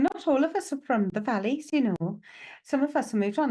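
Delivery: lilting, badly faked Welsh accent. Medium relaying a lady from the valleys, bad Welsh accent. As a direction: slip into a rough Welsh lilt. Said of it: "really bad Welsh accent there".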